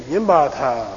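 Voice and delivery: Yimba ta falling low at the end; falling tune. Shown as a demonstration. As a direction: press on yimba, drop ta. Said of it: "Say again".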